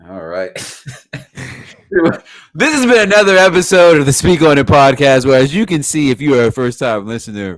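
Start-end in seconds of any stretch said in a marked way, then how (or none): 2.5-7.6 loud, projected podcast outro; big show-closing announcer delivery; announcer sign-off. Real, not acted. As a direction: belt out the sign-off, letting the pitch step down. Reads the show's outro.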